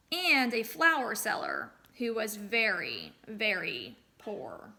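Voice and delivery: storyteller reading aloud to a class; story narration. A narrator's reading. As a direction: tell the folktale to the children.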